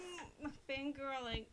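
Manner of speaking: ordinary talk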